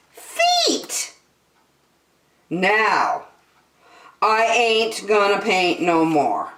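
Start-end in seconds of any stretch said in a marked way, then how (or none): 0.1-1.2 squeaky high punchline. A narrator's reading. Reader landing the rhyme for kids, squealing high. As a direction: leap way up on feet.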